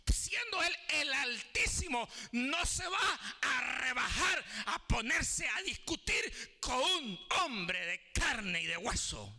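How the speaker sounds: high, drawn-out pulpit cries; full-force preaching. Real, not acted. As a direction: proclaim it fervently to the congregation.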